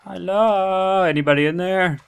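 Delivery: loud, high-pitched call into a house. Real, not acted. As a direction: call out to find someone hiding. Calls to hidden player.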